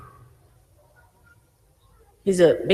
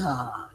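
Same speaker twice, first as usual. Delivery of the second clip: mock weary sigh, low and soft; exhausted sigh; 7 semitones lower, 11 dB quieter than usual. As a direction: let out a long, drawn-out "ah" as if worn out. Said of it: "here we are already exhausted".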